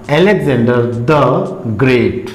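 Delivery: अलेक्जेंडर द ग्रेट spoken slowly, with द as a short "thuh"; slow model reading. Shown as a demonstration. Teacher slowly models the phrase for students, short "thuh". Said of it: "इसको कैसे pronounce करेंगे".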